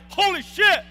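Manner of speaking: shocked burst, high and loud; amazed exclamation. Retold or imitated. Telling the audience how he reacted, loud amazed outburst.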